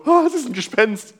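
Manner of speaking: high, hammed-up "Ah" of fright; exaggerated mock terror; mock ghost panic. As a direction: play the scared disciples for laughs. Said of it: "und alle so".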